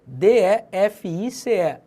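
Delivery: letters D E F I C E said slowly one at a time; slow letter-by-letter spelling; spelled out. Shown as a demonstration. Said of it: "défice".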